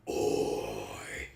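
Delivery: very low gruff oi; deep voice. Pretend. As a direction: drop way down, grunt it. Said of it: "has a really deep voice".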